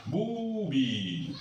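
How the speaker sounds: "Boubi" said slowly with a long "ou" and a falling end; careful demo word. Shown as a demonstration. Teacher modelling an Arabic word for French students, slow and careful. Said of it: "la voyelle longue ou".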